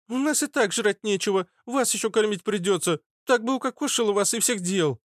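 put-on high-pitched sneering street girl; prickly redhead girl. (дерзкая девчонка, высоким голосом)

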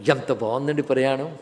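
ordinary talk

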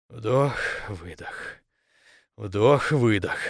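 low, slow, spaced words; self-calming breathing. A narrator's reading. Captain steadying his racing heart, slow and calm. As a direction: pace each word like a breath. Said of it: "сделал глубокий вдох, чтобы успокоиться".

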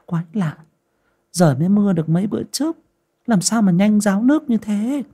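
put-on high, quick puzzled girl's voice; lẩm bẩm thắc mắc; puzzled muttering. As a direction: wonder aloud at the dry well. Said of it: "Con Ngân lẩm bẩm".

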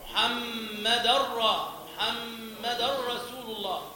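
slow, the tanween of محمدا flows straight into the ra of رسول; merged without ghunna. Shown as a demonstration. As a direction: glide محمدا into رسول with no nasal hum. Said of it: "فيتعين الإدغام بلا غنة". (إدغام بلا غنة في محمدا رسول)

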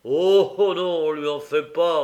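scoffing reply. Acted. Policeman answering gentleman.

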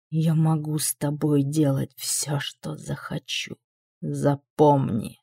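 low, slow, soft menace; a slow cold demon threat; menacing demon voice. A narrator's reading.

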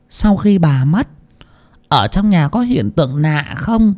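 lightly voiced fortune teller probing about ghostly signs; muttering questions. A narrator's reading. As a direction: ask warily as if muttering. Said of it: "lẩm bẩm trong miệng mà hỏi".

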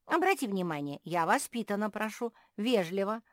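high, loud squirrel voice; indignant appeal. A narrator's reading. Squirrel appeals to the owl, loud indignant appeal.

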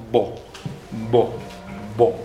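"bo" said three times, lips pressed firmly together; "bo", stressing the lips; labial bo sound. Shown as a demonstration. Teacher drilling the labial "bo" for the class. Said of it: "la bo es labial".

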